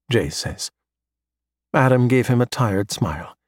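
ordinary delivery

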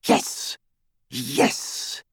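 breathless whispered yes; eager hushed exclamation; under-the-breath excited yes. Acted. Narcissa's eager hushed confirmation.

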